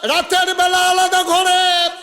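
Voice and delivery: loud, high melodic preaching; full-force sermon. Acted. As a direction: chant it out to the crowd, drawing out line ends. Preacher scolds women in crowd.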